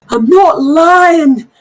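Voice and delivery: high, slow, frantic protest; desperate shout. Acted. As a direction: shout it, pleading to be believed. Halfling NPC protests.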